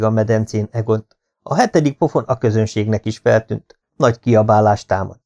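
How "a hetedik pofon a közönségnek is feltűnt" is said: ordinary delivery, nothing marked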